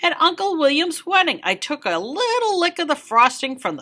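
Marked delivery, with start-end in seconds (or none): 0.0-1.4 bright opener. A narrator's reading. Story opener.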